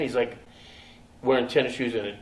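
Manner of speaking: normal talking voice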